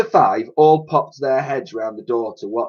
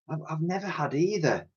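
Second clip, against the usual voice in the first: put-on soft, high little-girl voice; hushed and higher-pitched; small girl's reply; 5 semitones higher, 11 dB quieter than usual. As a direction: answer timidly, very softly. Shy hungry girl replies.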